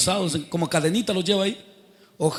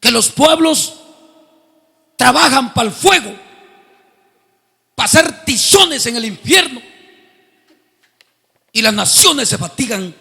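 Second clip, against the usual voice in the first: loud, high preaching; fiery preaching; 4 semitones higher, 6 dB louder than usual. Acted. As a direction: thunder it from the pulpit. Preaching at full force. (predicación a todo pulmón)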